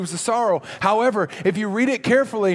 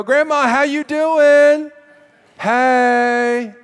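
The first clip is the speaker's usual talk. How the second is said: loud, high, drawn-out greeting; calling out to grandma; 6 semitones higher, 8 dB louder, much slower than usual. Acted. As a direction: hail Grandma loudly, stretching the words. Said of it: "walk out of here and go".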